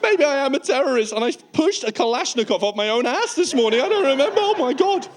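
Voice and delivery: high-pitched spiralling paranoia; worried traveller losing it, pitch climbing; mock panic. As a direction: work yourself up into comic panic. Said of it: "You start to really worry about things".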